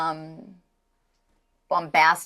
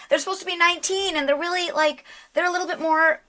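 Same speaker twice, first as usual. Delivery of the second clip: put-on much higher, innocent nineteen-year-old; innocent young voice; 9 semitones higher than usual. Shown as a demonstration. Actor demos a higher voice for young characters. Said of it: "do something here".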